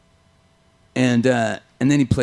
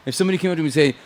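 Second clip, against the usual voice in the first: ordinary delivery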